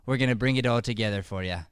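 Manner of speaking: usual speaking voice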